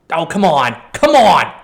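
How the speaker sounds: loud exasperated come on; groaning outburst at bad luck; frustrated yell. Real, not acted. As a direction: shout it at the crossbar. Goal hits the post, frustration.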